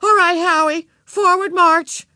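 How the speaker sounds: high, loud marching command; brisk order, last words stretched. A narrator's reading. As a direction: call it out like a young parade leader. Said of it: "Beezus ordered".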